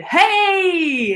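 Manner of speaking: high, drawn-out "hey"; called-back greeting. Retold or imitated. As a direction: imitate a cheery reply called from afar. Retold greeting called back in street.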